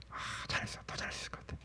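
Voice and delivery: whispered with a sigh; grudging self-praise. Acted. Preacher mimicking grudging praise to oneself, sighing whisper. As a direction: sigh it out under your breath, barely voiced. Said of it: "이런 식으로 얘기하면".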